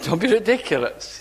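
brushing it off, mock scornful; dismissive. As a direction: wave it away like a silly idea. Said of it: "they didn't say that, but they meant it".

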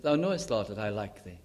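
low and soft, sinking away; subdued, sad reply. Retold or imitated. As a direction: imitate a quietly crestfallen answer. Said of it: "Sad, isn't it?".

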